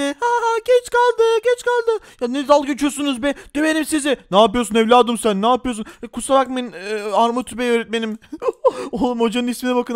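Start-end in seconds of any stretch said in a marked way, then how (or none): 0.0-2.0 put-on high, taunting child bully voice; sing-song sneer at the late kid; mocking bully. 8.2-8.9 short high-pitched giggle. Real, not acted. Laughing at teacher's name.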